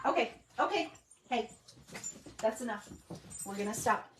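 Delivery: quietly but firmly shut the dogs down; scolding the dogs. Real, not acted. Crafter telling her dogs to stop, soft and firm.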